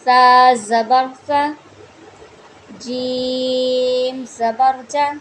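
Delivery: very slow, loud spelling; spelling drill. Shown as a demonstration. Teacher spelling out Qaida syllables for students, slow and loud. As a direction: draw out the letter names carefully. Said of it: "इसको लंबा भी नहीं करना".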